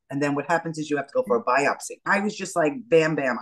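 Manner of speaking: ordinary talk, nothing marked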